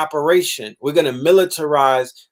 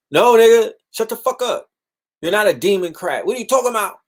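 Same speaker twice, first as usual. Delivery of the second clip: scolding; 9 semitones higher, 3 dB louder than usual. Real, not acted. Scolding the imagined partisan.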